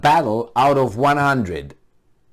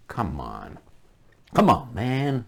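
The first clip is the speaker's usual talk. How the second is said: exasperated; 4 dB quieter, slower than usual. Real, not acted. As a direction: give up on them. Exasperated aside.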